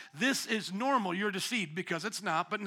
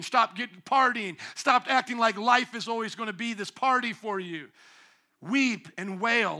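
rising, louder preacher's warning; full-force preaching; 3 semitones higher, 3 dB louder than usual. Acted. Preacher warning Israel it will get worse, loud and driving. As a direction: hammer the warning home to the crowd.

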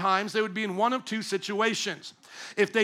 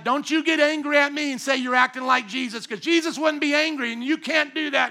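a touch of a higher, louder finger-wagging rebuke; mock scolding; 5 semitones higher, 3 dB louder than usual. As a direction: imitate a preachy scold telling someone off. Voices scolding believer.

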